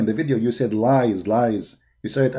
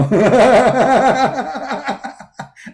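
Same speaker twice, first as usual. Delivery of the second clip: big scornful laugh, higher and louder; loud mocking laugh; 9 semitones higher, 5 dB louder than usual. Real, not acted. Debater laughing at his opponent's argument, scornful laugh.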